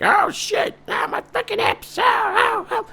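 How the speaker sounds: very high, strained yelps; old man in pain. Acted. Old man groaning to date about his hips, very high. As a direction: cry out over wrecked hips.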